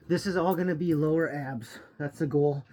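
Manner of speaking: ordinary talk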